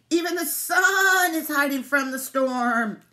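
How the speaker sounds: slow, high, loud bleat; drawn-out sheep lament. A narrator's reading. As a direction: stretch "sun" into a worried baa. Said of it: "bleated the sheep".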